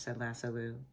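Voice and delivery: ordinary delivery, nothing marked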